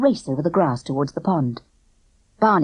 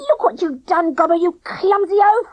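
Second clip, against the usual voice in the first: put-on high, louder girl's snarl; 13 semitones higher, 4 dB louder than usual. A narrator's reading. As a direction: snap at him for being clumsy. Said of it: "Clipper snarled".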